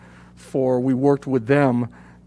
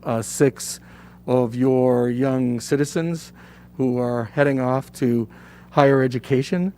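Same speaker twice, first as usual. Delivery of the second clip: normal talking voice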